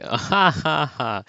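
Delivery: hammed-up "ha, ha, ha"; Professor Heimerdinger. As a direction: laugh it off as the chill professor. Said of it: "Heimerdinger's all chill".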